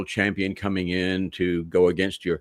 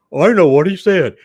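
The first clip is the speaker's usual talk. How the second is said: high, loud put-on know-it-all; mocking mimicry; 9 semitones higher, 10 dB louder than usual. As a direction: crow it in mockery of a listener. Mimics someone catching insider slang.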